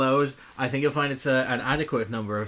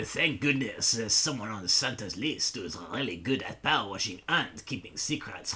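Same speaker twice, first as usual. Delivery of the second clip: low, put-on French accent; 3 semitones lower than usual. Streamer trying the game's intro in French accent, French accent. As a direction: read it in a hammed-up French accent. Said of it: "should I say this in a French accent".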